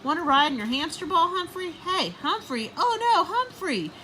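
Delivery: put-on high child's voice rising to alarm; young boy calling. Simon searching the room, worried calling. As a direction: call for the hamster, then panic. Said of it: "Simon's muffled voice say".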